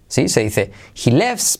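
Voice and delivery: ordinary talk, nothing marked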